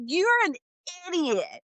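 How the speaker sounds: high scolding; exasperated. Retold or imitated. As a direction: call them an idiot, exasperated. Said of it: "I was like".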